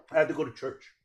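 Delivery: ordinary talk, nothing marked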